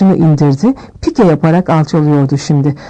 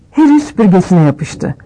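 ordinary delivery

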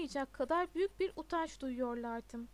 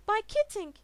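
high, loud appeal; insistent shout; 7 semitones higher, 10 dB louder than usual. A narrator's reading. Pupil keeps calling to his teacher, insistent shout. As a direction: call out over the reprimand. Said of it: "diye devam etti Todd".

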